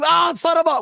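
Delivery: high pitched mock yell at the TV. Fan yelling at the TV over the game, mock yell. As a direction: yell at the screen, hammed up. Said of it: "yelling at a T V like".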